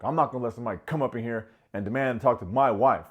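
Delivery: loud, fast mock alpha bluster; retold macho indignation; alpha male. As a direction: imitate a puffed-up husband laying down the law. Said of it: "feeling like an alpha".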